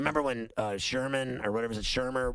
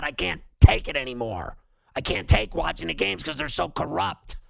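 angry outburst; 4 semitones higher, 6 dB louder than usual. Retold or imitated. Quoting own furious thought.

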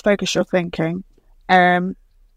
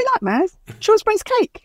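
much higher, sing-song colleague; colleagues voiced; 14 semitones higher than usual. Coworkers praising the visitor, mock high sing-song voice. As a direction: gush like a cake-loving coworker.

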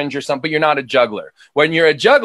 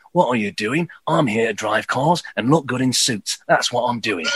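low, softer English action hero; Jason Statham; 4 semitones lower, 4 dB quieter than usual. Shown as a demonstration. Showing English varied further, low Jason Statham voice. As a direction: drop it down into a terse English tough guy. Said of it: "a little more Jason Statham".

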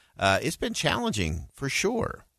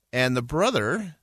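ordinary delivery

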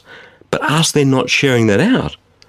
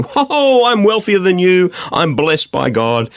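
high, smug boasting voice; mock boast; 8 semitones higher, slower than usual. Mocking wealthy churchgoers bragging about blessing, high and smug. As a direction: ham up a rich show-off.

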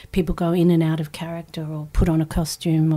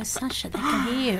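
hushed scolding; 4 semitones higher, 6 dB quieter than usual. Retold or imitated. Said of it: "people go".

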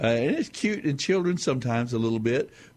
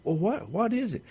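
higher, patient grandpa voice; grandpa answering; 11 semitones higher than usual. Retold or imitated. Grandfather retelling a bedtime story, playing grandpa's patient answer. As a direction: answer the boy from the doorway. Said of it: "And he says".

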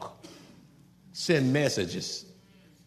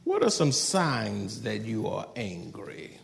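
ordinary talk

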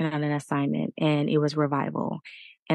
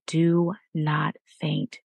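each word slow and deliberate: "do not faint"; slow, firm urging; much slower than usual. Real, not acted. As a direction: slowly lean on "do not faint". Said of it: "I want to encourage you".

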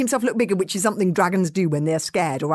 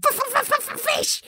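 high stuttering dragon hiss; squeaky dragon hissing, stuttering; stammering little dragon. Acted. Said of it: "said Toothless again".

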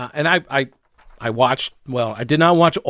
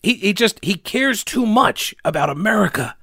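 mock-earnest, higher pitch; sarcastic mock sympathy; 5 semitones higher than usual. Host mocking the politician's excuse, sarcastic sing-song. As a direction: pretend to pity him sarcastically.

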